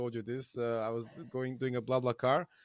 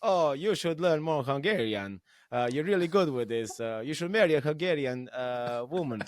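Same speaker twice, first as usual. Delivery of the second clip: put-on thick Hungarian accent; 4 dB louder than usual. Old Hungarian grandma urging the driver, in a thick Hungarian accent. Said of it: "with a thick Hungarian accent".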